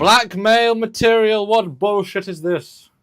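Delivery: loud, slow, high mock outrage; hammed-up indignant shout. Mock outrage at name threat.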